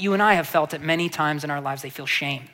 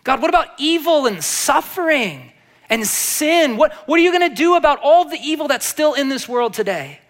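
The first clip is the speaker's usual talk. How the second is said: higher, louder cry to God; anguished plea; 6 semitones higher, 4 dB louder than usual. Retold or imitated. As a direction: cry out against the world's evil.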